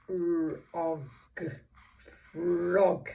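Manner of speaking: frog sounded out slowly sound by sound, then blended; phonics blending. Shown as a demonstration. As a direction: separate the sounds of "frog", then join them. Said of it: "blend these phonemes together".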